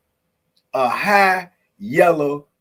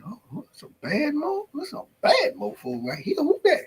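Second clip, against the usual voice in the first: higher, rising, puzzled questions; imitating a puzzled newcomer; 12 semitones higher, 4 dB louder than usual. Newcomer wondering who the streamer talks to, mock puzzled. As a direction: play a newcomer baffled by the chat. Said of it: "Probably was over there saying this".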